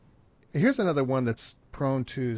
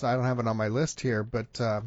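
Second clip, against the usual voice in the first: ordinary talk, nothing marked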